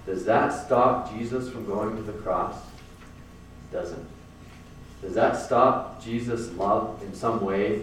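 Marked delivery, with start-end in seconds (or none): 2.5-4.1 breathy whispered answer. Real, not acted. Preacher whispering the answer to his own question. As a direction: drop to a whisper to say no.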